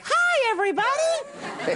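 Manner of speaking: very high, drawn-out cartoon greeting; exaggerated high mascot chirp; candy mascot voice. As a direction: squeal hello like a cheery candy mascot. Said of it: "it always sounds like".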